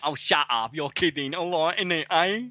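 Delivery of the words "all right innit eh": put-on light camp Essex accent; a breezy camp Essex greeting. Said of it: "You sound camp".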